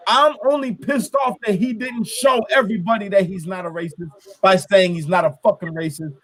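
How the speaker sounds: usual speaking voice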